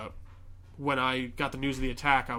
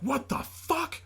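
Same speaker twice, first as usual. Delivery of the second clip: high-pitched retold disbelief; incredulous, pitched-up exclamation; outraged disbelief; 13 semitones higher, much slower than usual. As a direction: imitate a shocked outburst, pitch leaping up. Man discovers the hidden suit.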